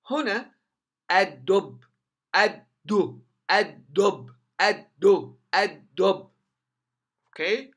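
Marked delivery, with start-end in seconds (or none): 1.1-6.3 "الدب" loud, as ad-dubb with no l; doubled dal. Shown as a demonstration. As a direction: drill "الدب" in punched halves, d held double. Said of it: "وتشدد الدال". (نطق "الدب" بدال مشددة ولام ساقطة)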